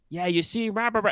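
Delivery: high, loud muffled banter; guard chatter. Acted. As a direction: chatter through a closing door.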